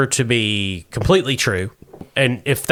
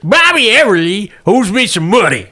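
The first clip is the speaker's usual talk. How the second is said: put-on old prospector; 7 semitones higher, 3 dB louder than usual. Wilbur voiced by the GM.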